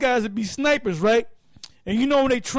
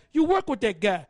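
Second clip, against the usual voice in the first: ordinary talk, nothing marked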